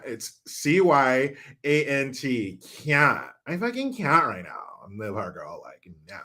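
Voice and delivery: put-on stretched Valley Girl vowels; hammed-up Valley Girl accent, "can't" as "cyant". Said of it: "Please do a Valley Girl".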